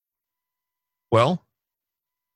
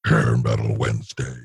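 deep radio announcer; 12 semitones lower than usual. Pretend. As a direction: drop it low and drag it out like a DJ. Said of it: "that wasn't burping".

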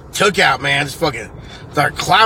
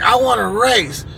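put-on higher-pitched kid demand; insistent child; 7 semitones higher than usual. Kid challenging another at the slide, imitated insistence. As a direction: imitate a kid begging to race.

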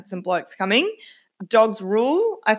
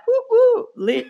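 high whooping hype, laughing at the end; mock party cheer; 3 dB louder than usual. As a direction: squeal like a hyped partygoer.

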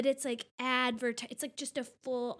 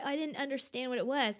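usual speaking voice

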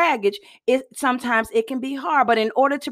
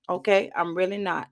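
ordinary delivery

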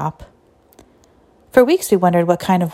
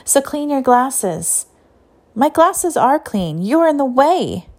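put-on bickering girl; 7 semitones higher than usual. A narrator's reading. Sisters bickering at lesson.

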